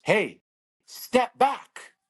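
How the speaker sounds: high, loud call from the stands; coach shouting from the crowd. Retold or imitated. Brother calls out from the crowd, loud coaching yell. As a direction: yell it like a coach cutting through crowd noise. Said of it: "my brother goes".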